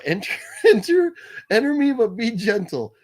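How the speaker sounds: laughing joke about a middle name; laughing tease. Acted. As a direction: giggle through a cheeky innuendo. Mock innuendo through laughter.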